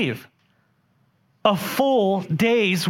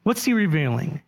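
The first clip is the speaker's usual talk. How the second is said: normal talking voice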